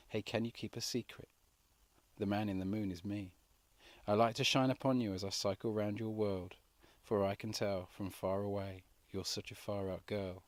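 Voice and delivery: hushed and soft, a lover's secret; soft confiding voice to a girlfriend; whispered secret. A narrator's reading. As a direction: lean in and murmur it. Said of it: "I whispered to my girlfriend".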